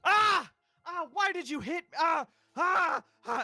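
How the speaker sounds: high shrieking yells; slapped man howling Ah; panicked screams after slap. Acted. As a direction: scream in shock and terror.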